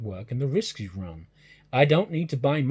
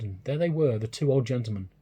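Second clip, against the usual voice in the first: plain reading voice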